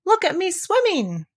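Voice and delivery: high, slow proud squeak; squeaky Roo. Acted. Roo answers his mother from the pool, squeaky. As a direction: show off, drawn out. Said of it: "was answering".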